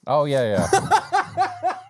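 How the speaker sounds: embarrassed laughing yeah. Real, not acted. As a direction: laugh through the yeah at your slip. Realizes own mistake.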